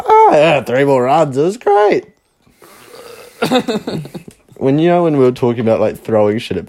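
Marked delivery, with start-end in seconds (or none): 0.0-2.1 mock cheerful. Mocking the relatives' cheerful report.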